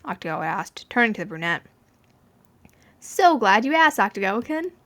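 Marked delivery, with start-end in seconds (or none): 3.0-4.7 chirpy. A narrator's reading. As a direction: sing it out, pleased with yourself. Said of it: "Dazai chirped".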